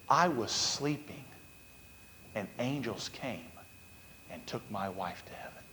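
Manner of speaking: measured phrases with pauses, sinking almost to nothing; hushed retold awe. Preacher quoting widower's realization, hushed and reverent.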